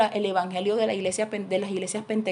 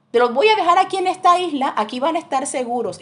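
raised, fast boatman's promise; boatman reassuring; 6 semitones higher, 6 dB louder, faster than usual. Acted. Boatman leaving the missionaries on the island, raised firm promise. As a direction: call it out firmly as he leaves them on the island.

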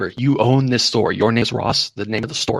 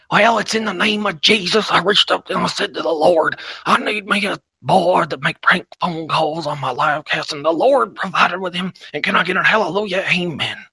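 raised, fervent revival-preacher voice; mock preacher; 6 semitones higher than usual. Preacher thanking the Lord for a prank-call board, hammed-up fervor. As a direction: testify to the congregation in a hammed-up sermon.

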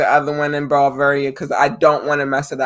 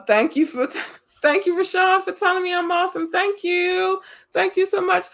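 much higher, laughing, flustered thank-yous; giddy thanks. Real, not acted. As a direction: squeal your thanks, touched and giggly. Thanked by Rashawn, giggling.

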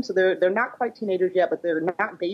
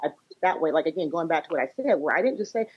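usual speaking voice

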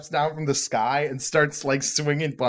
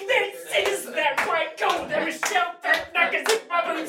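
high voice; 14 semitones higher than usual. Shown as a demonstration. Warm-up pattern game.

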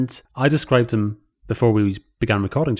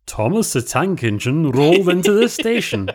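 Ringo narrating Thomas the Tank Engine, pitched up and louder; [name] impression; 6 semitones higher, 4 dB louder than usual. Shown as a demonstration. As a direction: read it like a gentle Ringo storyteller. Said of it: "impersonation of [name]".

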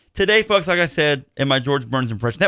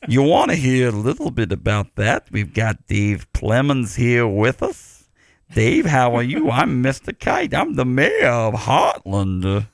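put-on low, slow old showman voice; George Burns as Mister Kite; 3 semitones lower than usual. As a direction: play an old vaudeville mayor, slowly. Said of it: "That's my George Burns impression as Mister Kite".

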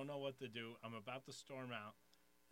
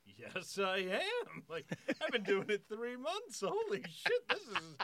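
high, loud, giddy disbelief; thrilled boast; 10 semitones higher, 12 dB louder than usual. Retold or imitated. Three-month comic recognized by gorgeous woman, thrilled loud boast. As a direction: burst out loud and high, half laughing. Flattered novice, delighted at recognition.

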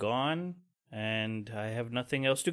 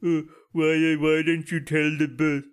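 mock whiny stammer, a bit louder; whiny coworkers; 4 dB louder than usual. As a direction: imitate sulky coworkers whining. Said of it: "they've got faces made".

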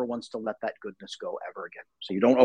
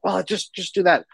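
higher, mildly exasperated snap; retold snapping; 7 semitones higher, 4 dB louder than usual. Dad imitates snapping at his kid, exasperated. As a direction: snap impatiently, imitating an irritated parent. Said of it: "I snapped at my kid".

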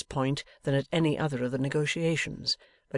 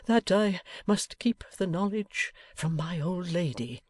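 higher, slow, plaintive voice; despondent; 5 semitones higher, slower than usual. A narrator's reading. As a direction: plead it dejectedly, drawn out. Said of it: "in a tone of despondency".

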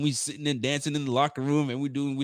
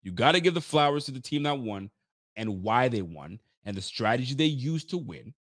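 usual speaking voice

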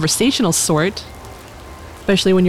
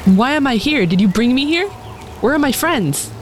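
higher, urgent questions; alarmed; 7 semitones higher than usual. Acted. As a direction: demand answers, rattled. Kanina confronts the dragon, alarmed.

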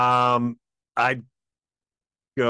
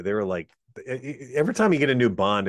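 normal talking voice

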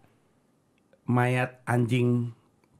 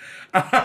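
shocked laugh; 6 semitones higher than usual. Real, not acted. Shocked by the grim answer.

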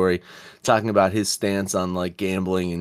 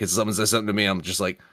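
ordinary delivery

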